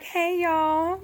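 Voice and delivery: high, singsong mock greeting; friendly cat voice. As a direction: voice a cat strolling back in innocently. Cat returns friendly after growling.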